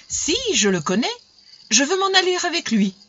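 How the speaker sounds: happily insist, pitch lifting; joyful. A narrator's reading. Daughter insisting she will go with him, joyful. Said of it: "d'un air joyeux".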